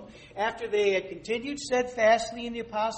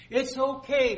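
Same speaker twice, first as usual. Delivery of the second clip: mocking quote; 5 semitones higher, 8 dB louder than usual. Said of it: "they say".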